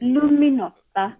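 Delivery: Luminosa slow and clear, loo-mee-NO-sah; slow Mexican Spanish Luminosa; Spanish pronunciation. Shown as a demonstration. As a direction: stretch Luminosa the Mexican way, slowly. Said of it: "with a Mexican accent".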